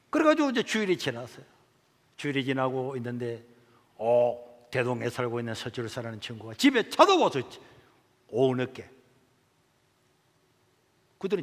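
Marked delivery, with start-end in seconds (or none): none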